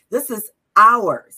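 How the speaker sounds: slow, loud and firm; defiant loud declaration. Retold or imitated. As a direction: plant your feet and declare it. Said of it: "I was like".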